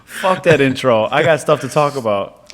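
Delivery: loud, high dismissive outburst; mock impatient rant. Host scrapping the intro to vent, mock angry. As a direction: bulldoze past the intro, playfully worked up.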